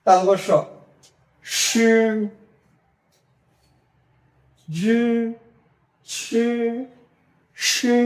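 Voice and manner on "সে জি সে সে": জি and সে drawn out with a push of air; throaty pinyin sounds. Shown as a demonstration. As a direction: hold জি and সে long, pushed from the throat. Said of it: "গলা থেকে উচ্চারণ করব".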